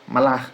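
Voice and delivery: "malar" as one short joined word; brisk ma-LAR; contracted form. Shown as a demonstration. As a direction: say "malar" without a break. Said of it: "na contração, fica malar".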